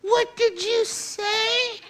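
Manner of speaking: high, slow, stretched indignant what did you say; mock offended reply. Preacher mimics hearer of truth, slow high offended squeak. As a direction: play the shocked listener, drawn out high. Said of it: "You're like".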